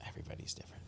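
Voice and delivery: very soft, voiceless whisper; whispered aside. Real, not acted. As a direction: whisper it under your breath. Agrees quietly under his breath.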